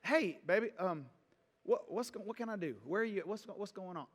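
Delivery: soft, caring check-in with wife; tender concern. Shown as a demonstration. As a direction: gently ask what she needs. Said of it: "go to the kitchen and say".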